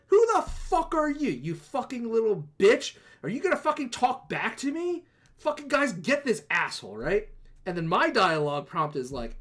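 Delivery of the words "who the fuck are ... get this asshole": much higher, louder mock rage at the paladin; mock furious drow. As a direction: shriek abuse like an offended villain. Freed drow insults the paladin.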